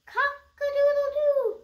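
high, loud, slow rooster crow. Shown as a demonstration. Reviewing animal sounds with the child, rooster crow. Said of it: "let's review".

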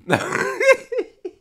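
a high squeal of a laugh. Real, not acted. Reacting to tweet.

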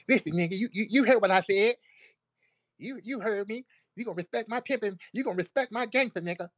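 high, lisping mock-tough voice, lisp every s hard; hammed-up lisping imitation of a wannabe gangster. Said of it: "With a whole lisp".

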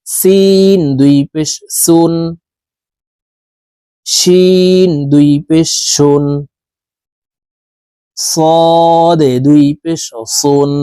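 slow, drawn-out Quran letter recitation; slow recitation drill. Shown as a demonstration. Teacher drilling students in slow tanween recitation. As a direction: chant each letter slowly for students.

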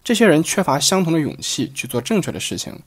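usual speaking voice

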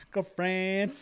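high teasing echo with a rise at the end; mocking her girlfriend again. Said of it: "I don't know why I said it like that".

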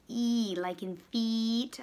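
long slow EE as in feet; slow vowel-sounding demo; stretched E. Shown as a demonstration. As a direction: draw out the E sound. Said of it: "I hear that double E sound".

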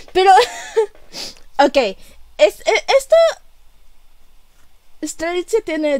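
ordinary talk, nothing marked

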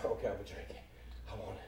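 low, soft acted murmur; hushed and low, talking yourself into it; giving in to temptation. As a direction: sink into a quiet, guilty confession.

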